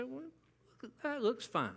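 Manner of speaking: offhand, unimpressed fine; flat fine. Shown as a demonstration. Husband shrugging at his wife's new hairdo, offhand. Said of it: "Fine is not an acceptable response".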